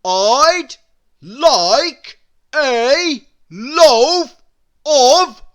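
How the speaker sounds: very loud, high, slow roar. A narrator's reading. Mister Noisy roaring at the baker, very slow. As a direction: roar every word, drawn out. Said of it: "roared Mister Noisy".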